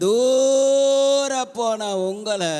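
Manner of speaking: loud, high and very slow, each word stretched; preacher's shout. Real, not acted. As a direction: call it out long and loud to the far-off listeners. Preacher peaks, drawn-out cry.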